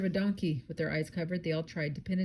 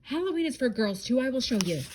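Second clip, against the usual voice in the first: higher-pitched, mildly shouted insistence; exasperated bear, pitched up; 6 semitones higher, 3 dB louder than usual. A narrator's reading. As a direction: insist in a raised storybook voice. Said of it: "Boris shouted".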